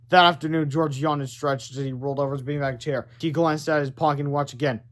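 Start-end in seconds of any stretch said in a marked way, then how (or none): none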